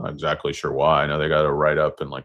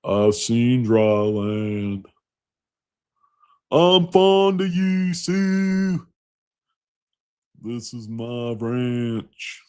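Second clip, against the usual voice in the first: very slow, much higher hammed-up movie delivery; Kevin Costner impression; 6 semitones higher, 3 dB louder, much slower than usual. Doing Kevin Costner lines very slow and high for viewers. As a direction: ham up earnest cowboy lines. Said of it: "You guys wanna do some Kevin Costner?".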